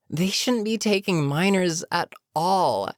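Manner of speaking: normal talking voice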